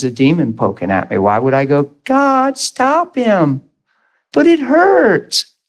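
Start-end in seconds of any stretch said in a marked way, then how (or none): 2.0-5.5 much higher, slow, whiny mock prayer; hammed-up whimpering complaint; mock whining plea. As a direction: ham up a self-pitying cry to God. Mocking a self-pitying prayer.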